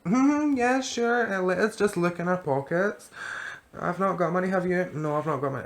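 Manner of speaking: high, put-on innocent stalling; feigned innocence. Passengers kidding on to cab driver, feigned innocence. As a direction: pretend to search, pitched right up. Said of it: "kidding on, we're looking for money".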